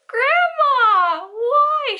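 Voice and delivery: high, loud mock wailing cry. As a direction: wail it like a betrayed kid, hammed-up. Betrayed child crying out.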